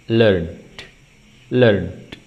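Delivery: "learned" as lernd, falling each time; learned with d ending. Shown as a demonstration. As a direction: voice the final d of "learned". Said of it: "Ada bunyi".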